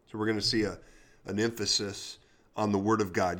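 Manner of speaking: ordinary delivery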